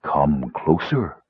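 low, slow mysterious voice; deep and drawn-out summons; soothing bass. A narrator's reading. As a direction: beckon slowly in a deep, soothing voice. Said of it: "said a soothing bass voice".

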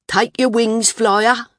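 high, loud mocking call; mock the flyer sharply. A narrator's reading. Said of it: "a voice said sharply".

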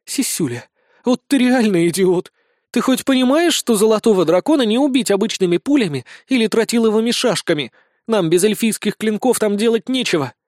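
high, heated telling-off; exasperated scolding. Acted. Blonde scolds the sergeant over the dragon, exasperated. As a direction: berate him for not grasping the danger.

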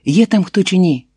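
high, insistent calling out; frantic shout. A narrator's reading. Woman calls into an abandoned well, frantic shout. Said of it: "кричу, як божевільна".

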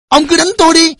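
high, loud desperate plea; pleading defiance. A narrator's reading. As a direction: cry out to take the blows yourself. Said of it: "Bà Thiện nhẫn nhục".

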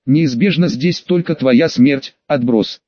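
plain reading voice

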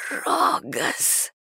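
soft, high, broken rasp; hoarse croak. A narrator's reading. Joan calling out from her vision, hoarse croak. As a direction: croak the name out weakly. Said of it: "прохрипела Джоан".

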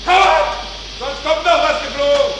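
high, strained angry yelling; furious shouting. Acted. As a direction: rage at the rotten pipes. Furious quarrel in engine room.

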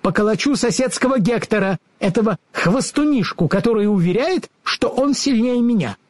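high-pitched, feisty little dog voice; small dog bragging in a high voice; little dog character. A narrator's reading. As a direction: chime in as a boastful pup. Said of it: "подхватил Татошка".

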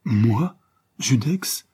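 high, incredulous double question; aghast exclamation. A narrator's reading. Unfortunate detective accused of being the avenger, aghast exclamation. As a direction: exclaim in shocked disbelief. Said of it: "s'exclama l'infortuné Prosper".

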